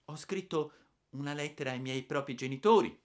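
put-on timid, high child voice; a touch of frightened boy; trembling reply. A narrator's reading. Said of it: "ho risposto tremando".

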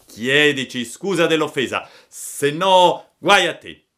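loud warning, rising and slowing on the threat; threatening demand. Acted. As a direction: demand an apology like a bully. Boy demands apology with threat. (minaccia arrabbiata)

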